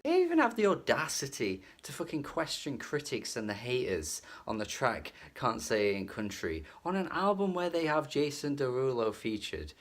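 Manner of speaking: ordinary delivery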